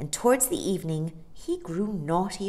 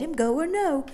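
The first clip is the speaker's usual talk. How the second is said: usual speaking voice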